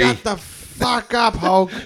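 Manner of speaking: mock yell. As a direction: bark it like an angry character. Acted quote from Curb scene.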